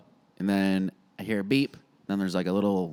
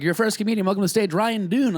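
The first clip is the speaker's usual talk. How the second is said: host announcement; 11 semitones higher, 4 dB louder than usual. Retold or imitated. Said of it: "the host is like".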